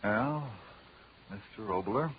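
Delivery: ordinary talk, nothing marked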